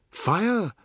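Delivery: high alarmed call; urgent cry. A narrator's reading. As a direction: shout the alarm. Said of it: "Savi said".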